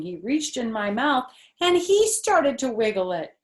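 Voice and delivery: put-on lively read-aloud voice for kids; animated children's book reading; story narration. A narrator's reading. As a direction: read it like storytime, voicing the characters. Reads Junie B. story aloud.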